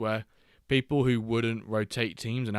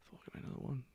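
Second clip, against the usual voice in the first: whispered curse; 4 semitones higher, 16 dB quieter, much faster than usual. Real, not acted. Conceding another goal.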